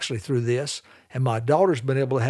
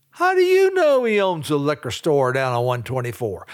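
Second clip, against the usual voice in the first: mock outraged outburst; 3 dB louder than usual. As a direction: burst out as if catching out a gossip, then come down. Acted inner outburst at the gossip.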